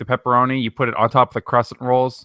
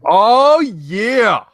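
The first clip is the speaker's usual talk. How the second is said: surprised; 9 semitones higher, 6 dB louder than usual. Real, not acted. Sudden discovery of the episode.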